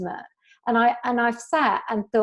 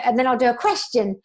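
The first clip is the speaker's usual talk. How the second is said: ordinary talk, nothing marked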